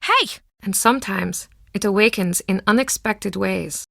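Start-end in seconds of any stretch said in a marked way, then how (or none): 0.0-0.4 sharp high call of alarm; startled hey. Acted. Pub owner spotting Nancy, sharp high startled call. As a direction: catch the intruder off guard.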